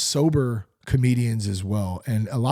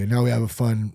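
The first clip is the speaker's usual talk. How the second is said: ordinary delivery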